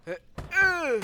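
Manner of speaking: high, loud strained grunt; startled grunt, acted out loud; pretend grunt. Pretend grunt as Freddy escapes.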